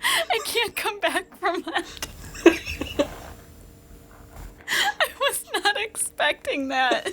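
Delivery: high, breathless speech through laughter; laughing helplessly. Real, not acted. Co-host unable to recover from Cody's hello, laughing. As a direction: talk while still cracking up.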